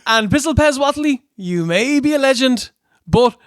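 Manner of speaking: high, earnest mock promo voice; imitated wrestling promo. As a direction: send up a polite rookie's challenge. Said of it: "He's like".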